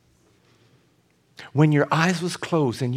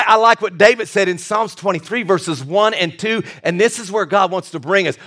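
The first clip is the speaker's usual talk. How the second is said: ordinary talk, nothing marked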